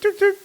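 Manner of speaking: high beep-beep like a tuk-tuk horn; horn imitation. To his co-host, mimicking a tuk-tuk horn, high honk. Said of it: "Could you do that again?".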